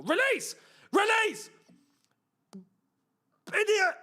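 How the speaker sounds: loud, high frustrated yell; shouting at the screen. Real, not acted. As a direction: bark it at the player. Yelling at player on screen.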